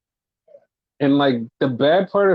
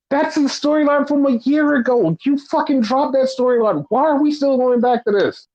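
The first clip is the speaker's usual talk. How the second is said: higher, a fed-up heated rant; exasperated rant; 11 semitones higher than usual. Real, not acted. Podcaster venting to cohost about tag team storyline, exasperated. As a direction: let the frustration pour out.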